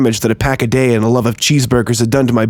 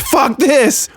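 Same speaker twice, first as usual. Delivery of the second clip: drugged man screaming as he breaks loose; 13 semitones higher than usual. A narrator's reading. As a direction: scream it as you fight free. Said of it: "and then screamed".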